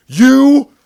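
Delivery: slow, high, loud mock yell; retold furious scream. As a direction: scream the curses, drawing them out. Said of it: "just screaming".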